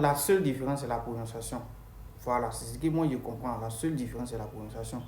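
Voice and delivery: usual speaking voice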